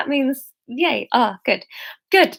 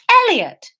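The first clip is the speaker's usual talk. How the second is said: Elliot called high and loud with relief; relieved loud call; cry out; 10 semitones higher, 4 dB louder than usual. Acted. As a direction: cry out the dog's name on finding him. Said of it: "the man cried".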